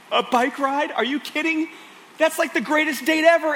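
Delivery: put-on a gasp, then high, amazed kid voices; little girls gasping at a bike ride, high and amazed; gasping surprise. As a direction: gasp and squeal like a thrilled child.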